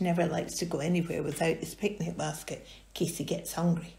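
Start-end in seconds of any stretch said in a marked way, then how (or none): none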